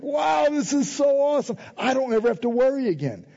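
high, excited burst of amazement; thrilled gasp of relief; excited exclaim. Retold or imitated. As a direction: blurt it out like it just dawned on you. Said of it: "I was like".

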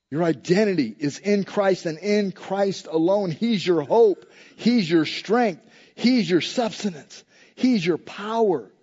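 ordinary delivery

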